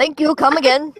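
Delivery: put-on Apu accent. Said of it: "Fucking Apu over here".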